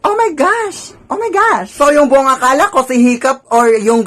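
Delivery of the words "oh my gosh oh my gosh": high, thrilled oh my gosh; squealing with delight; excited squeal. Retold or imitated. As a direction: gush like a starstruck fan. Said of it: "Me was like".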